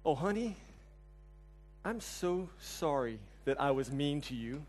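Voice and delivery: soft, slow, gentle "I'm so sorry"; tender apology. Retold or imitated. Husband soothing wife after her bad dream, soft and tender. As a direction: coax her with a hushed, tender voice. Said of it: "I say".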